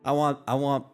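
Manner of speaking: ordinary talk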